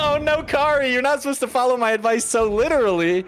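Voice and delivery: cackling oh no; laughing. Real, not acted. Laughing shout at fallen player.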